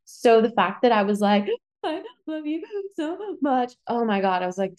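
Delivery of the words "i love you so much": high, slow, soft mock sobbing; imitated tearful choking. Bride imitating herself saying vows, tearful and barely able to talk. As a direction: squeak the words out through pretend tears. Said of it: "I couldn't even talk".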